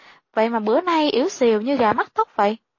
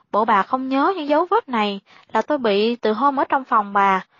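ordinary delivery, nothing marked

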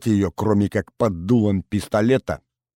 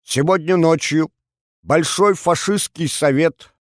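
radio announcer; 2 semitones higher than usual. Acted. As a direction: proclaim the decree to the whole nation. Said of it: "Голосом диктора".

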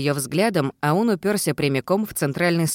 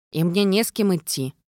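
ordinary delivery, nothing marked

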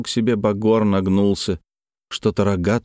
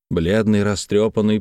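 usual speaking voice

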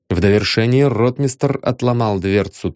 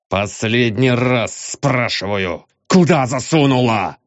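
barking. A narrator's reading. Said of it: "гаркнул ей в лицо".

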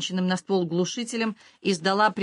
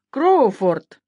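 put-on loud, raised call of a drunk man; shout; 4 semitones higher, 7 dB louder than usual. A narrator's reading. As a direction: shout the name to get his attention. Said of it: "снова крикнул большой Боб Бейли". (громкий окрик)

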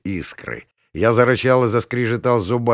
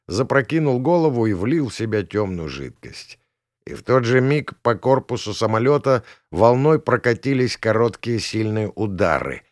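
usual speaking voice

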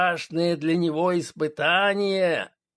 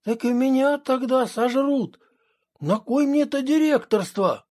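higher, faster alarmed protest; 6 semitones higher than usual. Acted. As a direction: push back in alarm. Alarmed objection to Petrovich.

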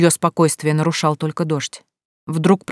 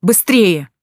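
louder, urgent cry; urgent shout; 2 semitones higher, 4 dB louder than usual. A narrator's reading. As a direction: hurry him out. Urging him out of water.

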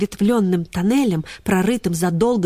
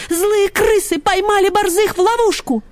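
alarmed; 13 semitones higher, 4 dB louder than usual. Acted. Ksyusha alarmed, still breathless.

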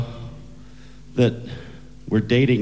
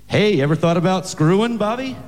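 pitched-up casual dad suggestion; lightly voiced father; 9 semitones higher than usual. Father makes a suggestion to his daughter's date, casual dad voice. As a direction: ask it brightly, lifting high on the name.

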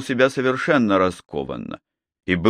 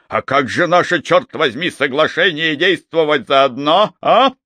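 higher and louder, rising to a yell; furious shout; 6 semitones higher, 4 dB louder than usual. Acted. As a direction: hurl it at him, climbing.

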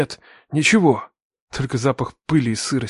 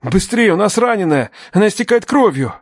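high, alarmed call for help; urgent shouting; 6 semitones higher, 3 dB louder than usual. Acted. Man hurries the rescuers to bleeding girl, urgent shout. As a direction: hurry them up in alarm.

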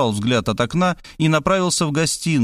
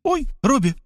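frightened cry; 13 semitones higher than usual. A narrator's reading. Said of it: "закричала она".

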